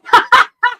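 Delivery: sudden loud high laugh; squealing laugh; high burst of laughter. Real, not acted. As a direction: break out laughing. Laughing at the dog.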